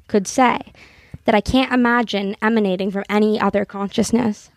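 earnest literary reading, lightly performed; reading a novel aloud. Acted. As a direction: read the passage like a heartfelt confession. Reads a novel passage aloud.

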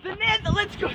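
very high, soft squealed cheer; mock excitement. Explorer echoing friend's Nether discovery, mock excitement.

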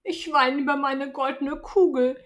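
a spoilt princess, startled then sulky; young princess. Acted.

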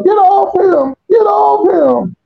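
high-pitched imitated plea, drawn out on the repeat; wife's frantic cry. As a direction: cry it out high like a wife defending her husband. Voices wife jumping into the fight.

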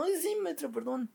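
high sugary fake sorry; hammed-up high meek apology; mock sweet apology. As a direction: play the innocent pupil in a squeaky voice. Kid mock apologizing to teacher.

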